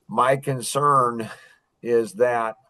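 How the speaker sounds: slow, deliberate sales voice; slow delivery. Shown as a demonstration. Salesman slowing down for an analytic buyer, deliberately slow. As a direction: draw each word out slowly. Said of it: "you talk slower when you're selling".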